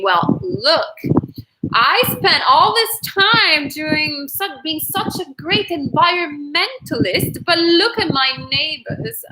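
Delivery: mock British snob looking down on the neighbors; fake British accent. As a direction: put on a superior, hammed-up British voice. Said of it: "fake British accent".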